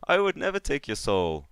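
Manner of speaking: reassuring soul collector in character; Baron Vol. Acted. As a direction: soothe the little one with dubious innocence. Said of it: "Baron Vol tries to peer around Krosek's shoulder and goes".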